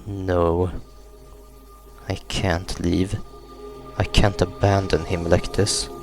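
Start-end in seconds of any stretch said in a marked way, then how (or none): none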